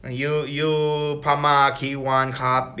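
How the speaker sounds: slow careful Thai phrases; slow basic Thai. Retold or imitated. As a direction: spell out simple Thai slowly. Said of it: "speaking to them in Thai".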